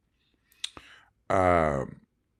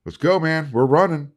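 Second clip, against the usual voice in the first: raised, louder coach's holler; imitated coach's scream; 9 semitones higher, 7 dB louder than usual. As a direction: call out like a coach hurrying a player along. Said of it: "Benny Wiley, screaming".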